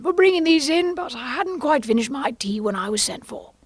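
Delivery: high, anxious apologetic voice; Hatter. Acted. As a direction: start very high, ease down while excusing yourself. Nervous Hatter apologises to King.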